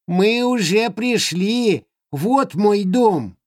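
high, slow creature voice; little creature proudly showing home; голос Хороняки. Acted. (высокий медленный голосок Хороняки)

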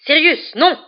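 high, loud cry to stop someone; alarmed shout; yelled. A narrator's reading. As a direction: yell it urgently, pitch jumping up. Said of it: "hurla Lupin en se précipitant".

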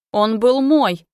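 loud, emphatic outcry; angry shout. A narrator's reading. Son insisting to his parents the child was his, shouting. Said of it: "закричал Матвей".

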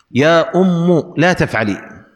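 "أم" slowly said ummu; final damma. Shown as a demonstration. Teacher showing the sixth form, "أم" said ummu. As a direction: put a short u on the end of "أم". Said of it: "وضم الحرف الذي كان مكسورا".

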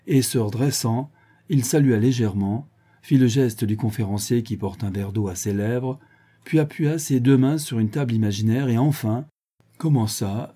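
usual speaking voice

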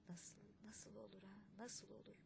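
whispered disbelief to himself; stunned whisper. A narrator's reading. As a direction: breathe it out, dazed. Stunned kaymakam muttering to himself. (şaşkın fısıltı)

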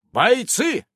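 commanding shout to troops; battle cry. Acted.

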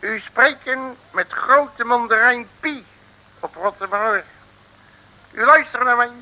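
hoger en luider, gebroken Nederlands als deftige mandarijn; nep Chinese mandarijn. Acted. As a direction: speel de grote mandarijn die plechtig opbelt. Nep-mandarijn belt Makke op. (nep-mandarijn die Makke plechtig belt)

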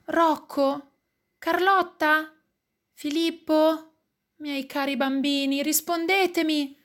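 high, anxious pleading; supplica. A narrator's reading. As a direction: call each child high, begging for an answer. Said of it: "supplica papà". (supplica acuta e preoccupata)

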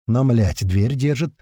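ordinary delivery

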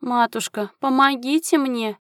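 high, plaintive call to mother; weak, pitiful wail; pleading moan. A narrator's reading. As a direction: whine it upward, begging to be rescued. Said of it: "простонал Фрэнсис".

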